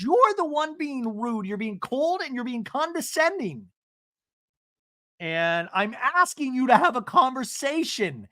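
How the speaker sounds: high, slow, insistent accusation; mock heated. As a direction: imitate someone heated, stretching the words. Acting out heated Emily.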